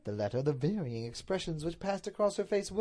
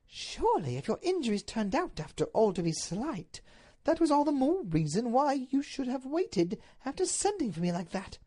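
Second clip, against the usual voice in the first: high lady's voice, aggrieved; pitched-up aggrieved wife; reproachful Duchess; 10 semitones higher, 3 dB louder than usual. Acted. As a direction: reason with him reproachfully.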